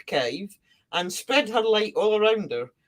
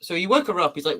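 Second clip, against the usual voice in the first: ordinary talk, nothing marked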